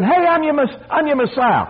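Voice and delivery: very high, hammed-up calling out; mock self-announcement. Preacher mimicking what Jesus didn't say, high mock hollering. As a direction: parody someone hailing the crowd in a high voice. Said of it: "He wasn't running around saying".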